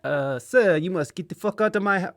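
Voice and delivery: put-on rude waiter with French accent. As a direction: kick him out in a French accent. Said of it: "I'm doing a French accent".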